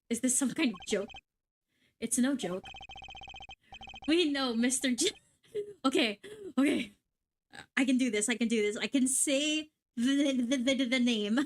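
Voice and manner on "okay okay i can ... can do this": usual speaking voice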